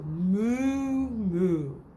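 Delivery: slow drawn-out moo like a cow. Shown as a demonstration. Teacher showing children a cow's moo. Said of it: "He can sound like a cow".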